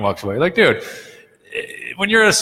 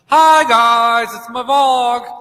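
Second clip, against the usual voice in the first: high, drawn-out sing-song greeting; mock a perky vlogger, pitched way up; exaggerated high sing-song vlogger voice; 12 semitones higher, 6 dB louder, much slower than usual. Mocks a celebrity gossip vlogger.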